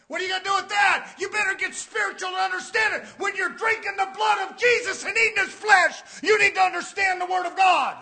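fired-up sermon delivery; forceful preaching. Real, not acted. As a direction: exhort the congregation loudly.

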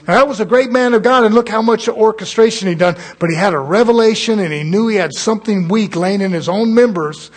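loud, high fired-up sermon; preaching at full force. Acted. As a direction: preach it hard to the congregation.

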